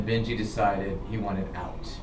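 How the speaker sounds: ordinary delivery, nothing marked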